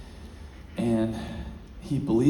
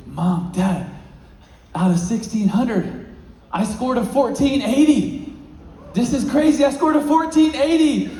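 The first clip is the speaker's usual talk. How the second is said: much higher and louder, laughing with joy; thrilled announcement; 11 semitones higher, 6 dB louder than usual. Retold or imitated. Son reading his test score to his parents, ecstatic and laughing. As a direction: burst out the score in disbelief.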